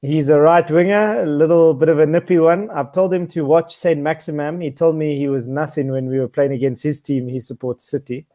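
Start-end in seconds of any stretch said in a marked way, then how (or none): none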